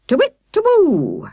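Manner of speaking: high, loud owl call; storyteller imitates the owl to carry on; owl hoot. A narrator's reading. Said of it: "Akela continued defiantly".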